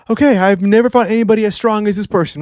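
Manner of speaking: mock out-of-breath, pitched high; panting Loki. Reviewer as Loki mid-battle with Imu, panting. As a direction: take a breath first, then high winded disbelief. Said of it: "and Loki is panting".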